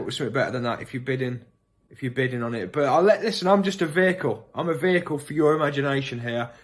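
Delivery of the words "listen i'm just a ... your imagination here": ordinary talk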